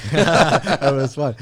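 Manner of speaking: laughing it out, slow and loud. Real, not acted. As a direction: laugh through the words at the memory. Same words, second time laughing.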